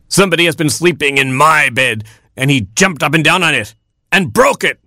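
lightly voiced upset father rooster; a hint of an indignant rooster; rooster crying out. Father rooster finding his bed broken, mild cry. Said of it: "cried Papa Rooster".

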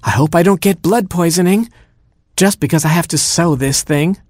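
high, wailing complaint over a pricked thumb; crying queen. Acted. Queen wailing to herself about her sore thumb, high and whiny. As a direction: whine it high like a hurt queen. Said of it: "she cried".

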